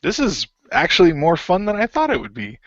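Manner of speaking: surprised he's enjoying the brawl; playful delight. Acted.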